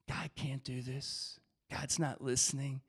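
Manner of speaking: low, soft voice of doubt; quietly discourage, low and subdued; discouraging inner voice. Acted. Doubt speaking to someone praying, low discouraging murmur. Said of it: "trying to get you to be quiet".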